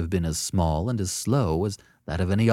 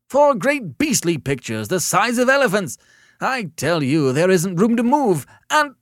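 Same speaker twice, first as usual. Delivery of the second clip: high, loud, exasperated caller; hysterical rant; 11 semitones higher, 7 dB louder than usual. A narrator's reading. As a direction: rant shrilly until cut off mid-sentence. Hysterical caller rants until cut off.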